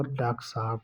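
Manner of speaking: "डॉक्टर साहब" shortened to daak-saahab; "डॉक्टर साहब" run together as daaksaab; least-effort contraction. Shown as a demonstration. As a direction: drop the end of "डॉक्टर" before "साहब". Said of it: "कम प्रयास करके किया जाए".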